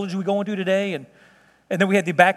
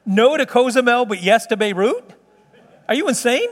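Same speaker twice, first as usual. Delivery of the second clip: loud, higher-pitched disbelief, rising to the question; stunned, punchy comeback; incredulous; 4 semitones higher, 3 dB louder than usual. Retold or imitated. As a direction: act out baffled outrage at the answer. Acted disbelief at wife's answer.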